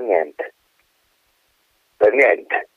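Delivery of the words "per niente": usual speaking voice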